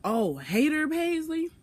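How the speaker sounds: mocking boyfriend. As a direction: sneer the nickname in a sing-song.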